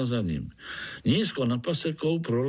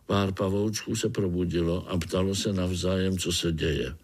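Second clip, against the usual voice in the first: usual speaking voice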